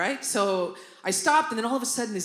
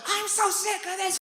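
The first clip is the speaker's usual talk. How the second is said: losing it; 10 semitones higher than usual. Retold or imitated. Daughter walking into parents' fight, acted furious outburst. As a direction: start high and explode on the last word. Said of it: "I lose my shit".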